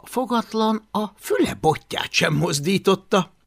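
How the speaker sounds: usual speaking voice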